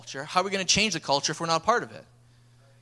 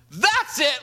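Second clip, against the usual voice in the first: high, fed-up outburst; worked-up reenacted exclamation; fired up; 8 semitones higher, 4 dB louder than usual. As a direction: explode like a coach who has had enough. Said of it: "now I'm just fired up".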